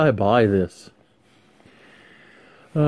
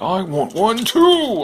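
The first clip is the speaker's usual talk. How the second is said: higher, slow, louder toy whine; Tiger Blanket voice; 9 semitones higher, 5 dB louder, slower than usual. Acted. Stuffed tiger asking for food, slow high whine. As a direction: beg like a little stuffed tiger. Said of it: "Tiger Blanket's like".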